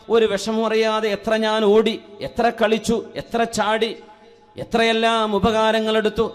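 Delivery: ringing sermon delivery with sustained phrase endings; projected preaching. Real, not acted. Preacher urging believers to thank Allah, projected sermon voice. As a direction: preach it out to a whole congregation.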